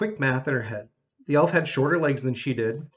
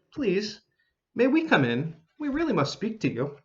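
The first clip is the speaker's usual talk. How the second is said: higher, pleading little elf voice; 7 semitones higher than usual. A narrator's reading.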